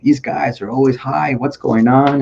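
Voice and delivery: put-on high, slow thick Québécois accent; exasperated French Canadian officer accent; Gaitan Roberge's French accent. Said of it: "Big, thick French accent".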